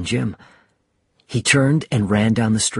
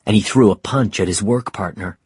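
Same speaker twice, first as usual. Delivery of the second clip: ordinary delivery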